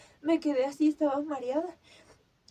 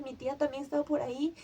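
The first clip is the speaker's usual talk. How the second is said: normal talking voice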